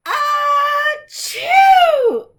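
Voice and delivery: an enormous shouted, high "Achoo"; a wolf's mighty hammed-up sneeze that knocks the house down; huge sneeze. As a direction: blast it out like a giant sneeze. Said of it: "I sneezed a great sneeze".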